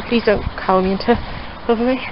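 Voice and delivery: ordinary talk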